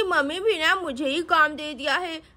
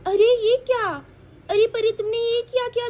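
ordinary delivery, nothing marked

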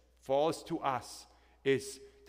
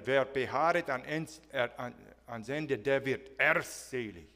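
mocking German emphasis. Pastor imitating how German preachers pressed the verse, heavy. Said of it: "it was taken to this level".

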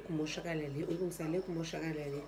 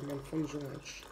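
softer and fast, mumbled under the breath; quiet sulky mumble; 4 semitones lower, 5 dB quieter, much faster than usual. Real, not acted. Vlogger sulking to viewers about her cup, quiet fast mumble. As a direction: mutter it quickly, sulking.

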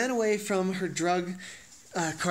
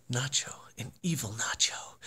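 spooky whisper; 5 semitones lower, 6 dB quieter than usual. Real, not acted. Whispering evil.

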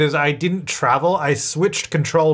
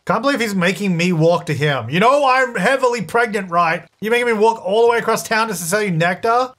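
ordinary talk

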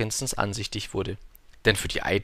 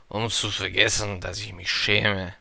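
slow, subdued drinker's admission; ashamed confession; 2 semitones lower than usual. A narrator's reading. Drinker confesses to the little prince, subdued and ashamed. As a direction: confess it glumly, slowing down. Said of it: "gestand der Säufer".

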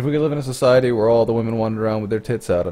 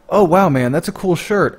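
much higher and louder, a stranger gushing; enthusiastic compliment; 7 semitones higher, 5 dB louder than usual. Retold or imitated. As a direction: call out a cheery compliment to a passer-by.

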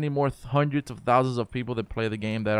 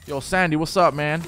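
loud, high, hammed-up shout-out; loud hyped greeting; 5 semitones higher, 6 dB louder than usual. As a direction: yell the greeting, way over the top. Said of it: "That was, that was awful".

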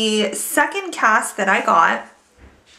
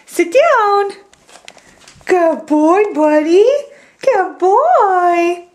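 high, louder pet talk; high dog-praise voice; 8 semitones higher, 5 dB louder, much slower than usual. Real, not acted. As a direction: gush over the dog, high and loud.

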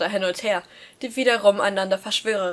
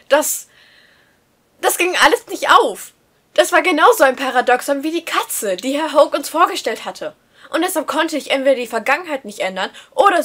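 agitated rising panic; 5 semitones higher, 6 dB louder than usual. A narrator's reading. Narrator panics over paradox.

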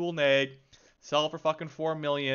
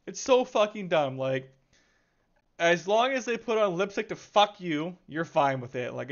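normal talking voice

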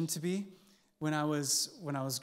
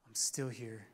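tearful reassurance; 6 semitones lower, 7 dB quieter than usual. Retold or imitated. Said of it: "with tears in my eyes".

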